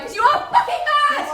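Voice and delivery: high, loud, fast scream with a laugh; screaming at her ex in fury; furious scream. Acted. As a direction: scream it back at him. Screaming back in a fight.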